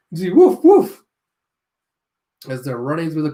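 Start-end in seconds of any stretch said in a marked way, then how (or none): 0.3-1.0 woof woof, much higher and louder, like a small dog; playful high dog barks; high yappy dog. Acted. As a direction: bark it like a little lion dog. Voicing the lion dog.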